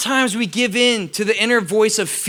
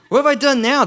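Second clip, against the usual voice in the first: normal talking voice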